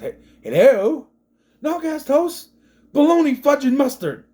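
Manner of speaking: high, loud indignant phone voice; mock exasperated caller. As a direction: act out an outraged phone call. Acts out phone call, exasperated.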